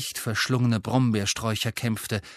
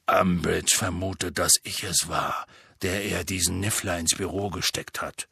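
low voice of the troubled gamekeeper; Hagrid; 5 semitones lower than usual. Acted. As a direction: explain the accusation in a deep voice. (tiefe Hagrid-Stimme, bedrückt)

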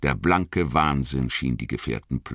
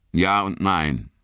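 slightly raised, hesitant reply; slow answer; 5 semitones higher, slower than usual. A narrator's reading. Boromir answers Aragorn after hesitating, slowly. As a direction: answer slowly, a touch higher. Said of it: "antwortete er langsam".

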